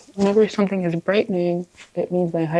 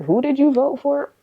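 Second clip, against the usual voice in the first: mock question; 8 semitones higher than usual. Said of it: "they're like".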